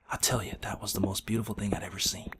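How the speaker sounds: hushed reverent whisper; awed whisper. Real, not acted. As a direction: breathe it out in awe. Awed aside about moonwalk.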